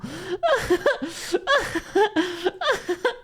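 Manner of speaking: high-pitched laughing fit; laughing hard. Real, not acted. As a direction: crack up in high, wheezing bursts. Cracks up at own joke.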